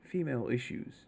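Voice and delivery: softly imitated, discreet question; hushed retelling of a furtive question; a little bit quietly. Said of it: "a little bit quietly, he goes".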